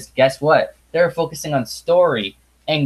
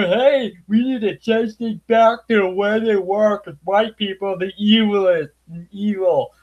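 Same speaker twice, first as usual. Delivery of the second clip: higher sneering mock voice; 6 semitones higher than usual. Reviewer parodying the villain's lines, higher mock voice. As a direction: ham up a cartoon villain's rant.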